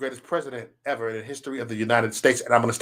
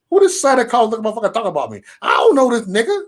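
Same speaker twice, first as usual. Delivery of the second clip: higher, rapid indignant outburst; retold indignant bafflement; 6 semitones higher, 3 dB louder, faster than usual. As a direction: act out puzzled outrage, pitched up. Retells his indignant first reaction.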